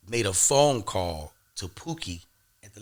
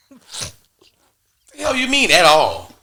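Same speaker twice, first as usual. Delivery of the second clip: indignant disbelief; 7 semitones higher, 8 dB louder, much slower than usual. Retold or imitated. Told he is not coming back.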